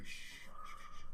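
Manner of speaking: soft whispered shushing; hushing. Real, not acted. Hushing a crying friend.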